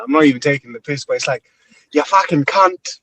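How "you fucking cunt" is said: slow, higher-pitched mock-angry Australian; hammed-up angry Aussie accent; mad Aussie accent. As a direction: imitate an Australian getting angry, drawn out. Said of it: "Aussie person get mad".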